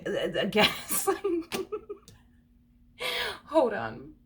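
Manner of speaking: words dissolving into high giggles; helplessly cracking up mid-phrase; real laughing. Real, not acted. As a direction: try to speak, lose it laughing. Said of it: "I can't help but to freaking laugh".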